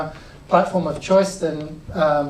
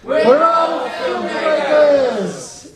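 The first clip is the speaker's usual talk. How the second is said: slow, much higher full-throated rally yell; crowd shout; 8 semitones higher, 4 dB louder, much slower than usual. Real, not acted. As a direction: belt it out together on the count. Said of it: "one, two, three".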